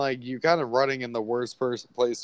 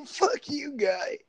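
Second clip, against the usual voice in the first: mock tough guy; 4 dB quieter, slower than usual. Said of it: "He's like".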